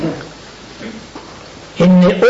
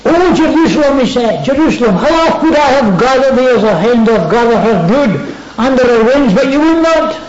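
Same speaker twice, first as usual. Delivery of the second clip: lament; 7 semitones higher than usual. Acted. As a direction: cry out over the lost city. Voicing Jesus lamenting city.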